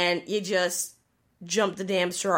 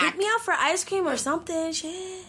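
mock complaint; 9 semitones higher than usual. Joking as the wronged date.

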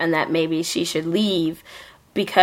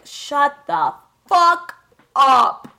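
slow, drawn-out and higher, punchy end; exasperated shut up; 8 semitones higher, 4 dB louder, much slower than usual. Retold or imitated. Friend retelling watching the whiny show, slow fed-up outburst. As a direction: snap in fed-up disbelief. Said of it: "you're just like".

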